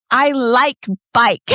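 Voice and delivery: put-on slow, high little-kid voice; imitating a toddler, drawn out. Dad retelling his daughter's bike clip, in a slow high toddler voice. Toddler quote in family story.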